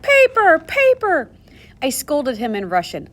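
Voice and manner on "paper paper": high, loud excited shouting; a small boy crying out with glee; wild gleeful cry. A narrator's reading. Little Ilya gleefully shouting at the toilet paper. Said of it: "he laughed wildly. He cried".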